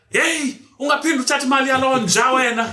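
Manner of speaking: hammed-up mum telling her off, quick and loud; scolding mother. Mother scolding daughter about money.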